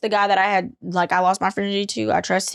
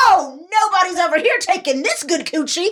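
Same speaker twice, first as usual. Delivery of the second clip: high, louder sassy put-on voice; sassy retort; 9 semitones higher, 3 dB louder than usual. Telling the guys off about her, high and sassy. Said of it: "I said".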